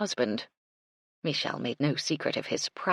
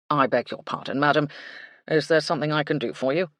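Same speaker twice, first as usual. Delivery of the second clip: polite butler; 4 dB louder than usual. A narrator's reading. Baxter's voice.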